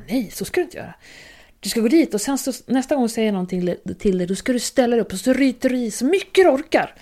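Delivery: a hint of dad's Lindy dialect, firm advice; lightly voiced father giving blunt advice in dialect. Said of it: "nu pratar jag Lindy-dialekt".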